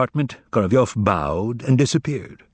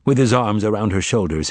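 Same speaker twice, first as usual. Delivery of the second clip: ordinary delivery, nothing marked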